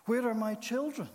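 high, loud pleading question; desperate cry. A narrator's reading. Lost man in hell calls for his children, desperate cry. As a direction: call out desperately, pitch lifted well up.

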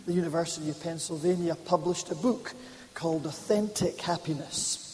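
ordinary talk, nothing marked

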